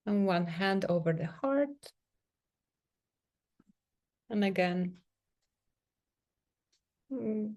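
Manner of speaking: soft, unhurried meditation voice with long pauses; guided meditation. Real, not acted. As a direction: gently guide them into sensing the body, leaving space between phrases. Leads a guided self-touch meditation.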